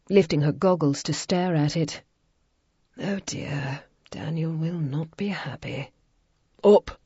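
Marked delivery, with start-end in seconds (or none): 3.0-5.9 soft whispered dismay. A narrator's reading. Violet whispers to Ian over the wrecked cycle. 6.6-7.0 short loud command; curt order. A narrator's reading. Ian calls Violet up the hill over the wind.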